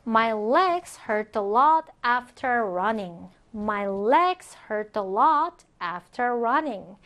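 slow, careful teacher's English; drawn-out, evenly spaced words; slow model reading. Shown as a demonstration. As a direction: read it slowly for learners to follow. Teacher models sentence twice.

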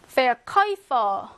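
Verkäufer drawn out very slowly, äu as 오이; slow German word demo. Shown as a demonstration. Teacher sounding out a German word for the class. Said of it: "음라우트에 우 있으면요, 오이".

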